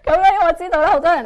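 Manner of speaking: words pushed out through laughter; real laughter over the words; laughing while talking. Real, not acted. Interpreter laughs while translating.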